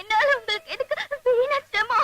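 tearful, sobbing protest; crying. Acted. Declining the man's help, sobbing. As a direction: talk through sobs. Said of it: "முன்னாடி அழுதா".

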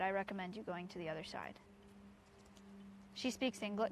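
normal talking voice

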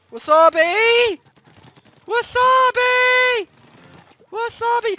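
slow high shouted wasabi; battle shout. Real, not acted. Gamer firing his weapon, drawn-out high battle shout. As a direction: bellow the weapon name. Yelling weapon name in fight.

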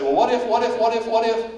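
put-on pestering teen. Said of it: "They'll say".

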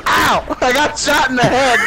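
hurt shout. Real, not acted. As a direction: yell like you got hit. Shot, yelling in pain.

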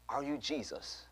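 soft, hushed retold question; curious question. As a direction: ask it quietly, in curious wonder. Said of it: "with the most curious look in her eye".

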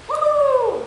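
high, loud whoop. Acted. Crayon leaping out of the box, loud whoop. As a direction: let out a joyful whoop.

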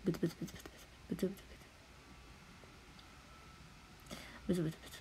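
near-silent mumbling under the breath; playful hushed muttering. Pretend. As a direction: mumble to yourself, very softly. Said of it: "これブツブツ言ってます".

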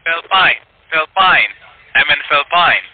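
"Philippine" as two syllables, "Fill-pine"; mispronounced Philippines. Real, not acted. Scammer repeating a false location, "Philippine" mispronounced "Fill-pine". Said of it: "a place that you cannot pronounce".

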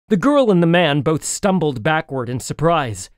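ordinary delivery, nothing marked

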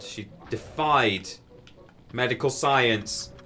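slow, deliberate pronouncement; dramatic emphasis. Real, not acted. Telling chat about grandma, slow and emphatic. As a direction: spell it out slowly for effect.

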